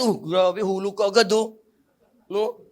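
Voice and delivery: ordinary delivery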